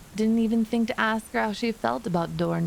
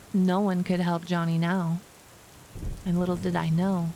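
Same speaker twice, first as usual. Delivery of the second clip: ordinary delivery, nothing marked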